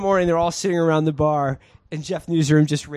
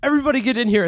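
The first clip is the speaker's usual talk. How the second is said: retold calling out; 8 semitones higher, much faster than usual. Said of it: "he's like".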